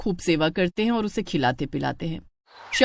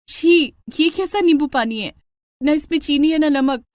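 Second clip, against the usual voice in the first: higher, louder cry of disgust; disgusted outburst; 6 semitones higher, 4 dB louder than usual. Acted. Bride spits out unsweetened lemon water, loud disgusted cry. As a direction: spit it out in disgust.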